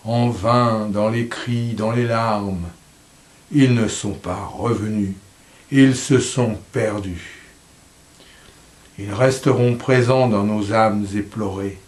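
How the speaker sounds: measured, lyrical reading with falling line endings; poem recitation. Acted. Reader mourning sailors lost at sea, measured poem recitation.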